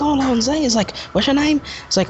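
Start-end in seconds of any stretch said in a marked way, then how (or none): 0.0-0.5 high mock-English accent; playful high-pitched English accent impression; Tenth Doctor. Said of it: "his English accent is kinda high". 1.1-1.6 high mock-English accent; playful high-pitched English accent impression; Tenth Doctor. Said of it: "his English accent is kinda high".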